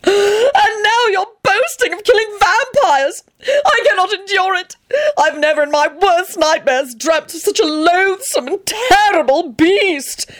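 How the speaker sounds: very high, tearful hysterical wail; wild scream. A narrator's reading. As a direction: shriek it theatrically in horror. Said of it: "he screamed wildly".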